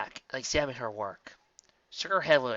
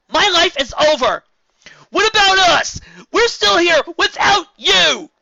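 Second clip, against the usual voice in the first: high, loud, slow anguished shout; fired back; 16 dB louder, slower than usual. A narrator's reading. Spike firing back at Twilight. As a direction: hurl it back at her. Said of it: "Spike fired back".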